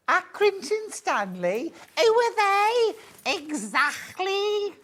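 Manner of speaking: put-on very high, incredulous Scouse kid; mock Scouse advert. Host re-enacting a milk advert punchline, high mock Scouse. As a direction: squeal it in drawn-out, exaggerated Scouse. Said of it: "Do you remember that advert?".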